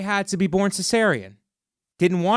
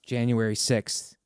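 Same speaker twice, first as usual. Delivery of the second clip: mock ominous; 7 semitones lower, 6 dB quieter than usual.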